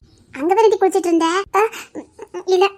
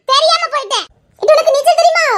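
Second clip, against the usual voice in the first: loud call; 9 semitones higher, 7 dB louder than usual. Acted. Loud exchange across the water.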